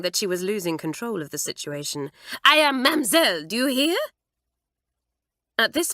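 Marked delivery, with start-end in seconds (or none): 2.4-4.0 loud, high French indignation; angry cry. A narrator's reading. Mamselle scolding Kitty, loud and angry. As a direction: insist on her title, furious. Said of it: "cried Mamselle".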